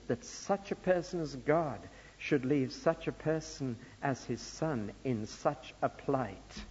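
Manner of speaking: ordinary talk, nothing marked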